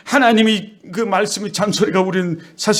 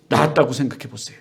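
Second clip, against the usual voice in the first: normal talking voice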